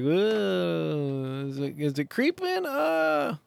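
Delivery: soft, drawn-out anxious fretting; acted-out nervous wavering; mock worry. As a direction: fret over it uneasily, ending on a high moan. Acting out watching the engine gauge.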